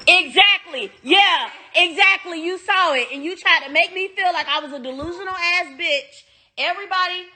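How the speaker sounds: indignant. Real, not acted. Indignant agreement.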